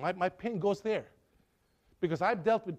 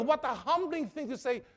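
normal talking voice

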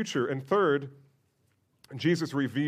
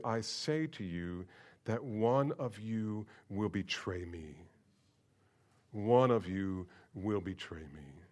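troubled; 5 semitones lower, 7 dB quieter, slower than usual. Acted. Jesus' troubled words read slowly.

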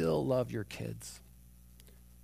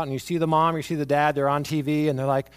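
usual speaking voice

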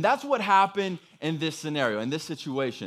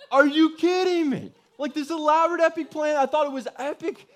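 loud, high-pitched incredulous outburst; hammed-up outrage at a spoiled secret; exasperated disbelief; 10 semitones higher, 5 dB louder than usual. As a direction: cry out in comic disbelief, voice way up. Retells disbelief that she knew.

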